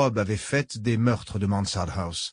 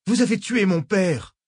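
higher, louder accusing cry; shouted; 9 semitones higher, 4 dB louder than usual. A narrator's reading. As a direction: hurl the accusation at her. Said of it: "hurla-t-elle".

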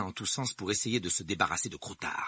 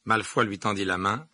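usual speaking voice

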